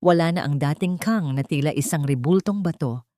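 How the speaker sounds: ordinary delivery, nothing marked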